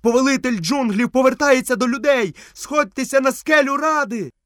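високий, гучніший заклик; крик. A narrator's reading. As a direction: cry it out high, like a herald calling everyone. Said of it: "бігав від одного до другого і кричав". (високий крик глашатая)

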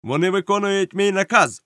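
high, forceful interruption; cutting in. A narrator's reading. Captain cuts the cook off, asserting his order. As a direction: cut him off with authority. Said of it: "обірвав його капітан".

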